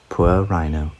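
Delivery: ordinary delivery, nothing marked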